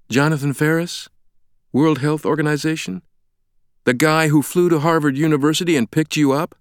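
high, incredulous rising questions; exasperated disbelief. A narrator's reading. Stranger reminding Langdon who he is, in disbelief. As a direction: prompt him as if it were obvious. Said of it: "threw up his hands in disbelief".

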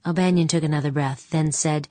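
ordinary delivery

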